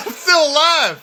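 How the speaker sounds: high, loud comic outburst; hammed-up indignant cry after a laugh; mock indignant. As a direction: protest loudly, imitating an outraged comedian. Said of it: "He's so mad".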